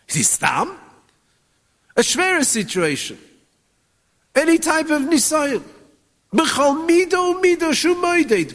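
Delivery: high, ringing sermon cadence; impassioned preaching. Acted. Rabbi urging listeners that every situation is from Hashem, high impassioned preaching. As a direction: lift them up with each phrase.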